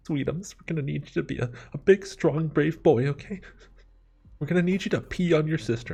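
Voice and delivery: high-pitched imitation of a coddling mother; babying mom. Mom asking her teenage son for help, babying voice. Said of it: "this is how she talked to me".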